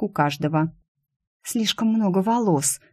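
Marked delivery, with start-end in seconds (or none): none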